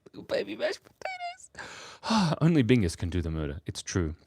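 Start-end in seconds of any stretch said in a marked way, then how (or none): 0.1-2.4 tiny, very high, slow squeak; baby potato voice. Pretend.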